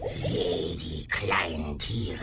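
slow, eerie creature voice; monster voice. Acted. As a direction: speak like a beast.

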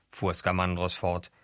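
ordinary delivery

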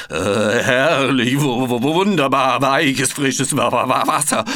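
teeth chattering. A narrator's reading. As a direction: chatter out each word in icy water. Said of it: "klapperte Frederik".

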